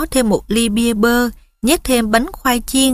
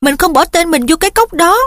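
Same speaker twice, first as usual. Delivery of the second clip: higher-pitched, heated insistence; raised pitch, temper starting to flare; angry denial; 7 semitones higher, 4 dB louder than usual. A narrator's reading. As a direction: protest with rising anger. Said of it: "Harry bắt đầu thấy giận điên lên".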